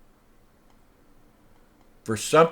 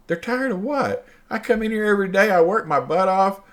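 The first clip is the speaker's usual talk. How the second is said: indignant disbelief; 8 semitones higher than usual. Retold or imitated. Indignant retold reply to accuser.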